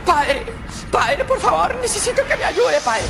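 high pleading voice; desperate woman. Acted. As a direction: beg the priest for help.

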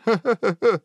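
high-pitched acted belly laugh; hammed-up ha-ha laughter; acted guffaw. A narrator's reading. Guard cracking up at the prince, acted guffaw. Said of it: "захохотал стражник".

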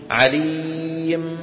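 drawn-out chanted عليم, tanween dropped; pausal form. Shown as a demonstration. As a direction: chant عليم slowly, ending on a bare m. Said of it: "كيف نقف على عليم يا شباب".

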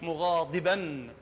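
slow مغاضبا with ض halfway between د and ط; مغاضبا ببطء بضاد بين الدال والطاء. Shown as a demonstration. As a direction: draw out مغاضبا, blending د and ط in the ض. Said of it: "يجمع بين الطاء والتاء ... أو الدال وياها".

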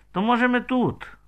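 high, loud, agitated question; hoarse with nerves. A narrator's reading. Ihor asking to stay, agitated and high. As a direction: blurt it out high and nervous. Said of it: "Голос в Ігоря геть захрип".

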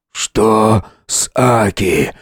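very slow, strained question; laboured. Acted. Wounded father asks about daughter, laboured. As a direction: drag each word out painfully.

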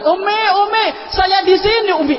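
high, loud call across a distance; child calling out. Retold or imitated. Lost child calling to his mother from afar, loud high call. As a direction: call out to mother from far away.

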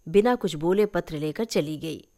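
ordinary delivery, nothing marked

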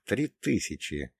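ordinary delivery, nothing marked

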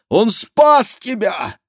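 high, loud, drawn-out rebuke; shouting. A narrator's reading. Baron reminds daughter of her rescuer, shouting. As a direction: shout each word out slowly. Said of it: "закричал барон".

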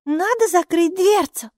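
high-pitched small voice; high, earnest little creature; little character asking. A narrator's reading. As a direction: ask in a high pipe. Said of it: "спросила Майки".

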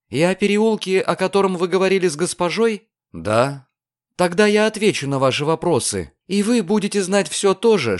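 put-on high girl's voice, setting terms; insistent young woman; determined girl. A narrator's reading. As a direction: bargain firmly in a high voice. Narrator voices the bargaining girl.

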